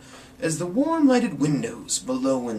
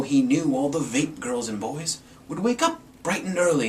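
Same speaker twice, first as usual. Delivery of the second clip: usual speaking voice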